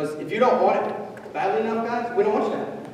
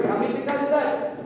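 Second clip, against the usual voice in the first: normal talking voice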